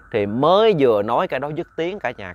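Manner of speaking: usual speaking voice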